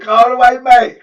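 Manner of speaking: loud, high barked order; mock command. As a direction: call it out like a boss. Booker ordering loudly.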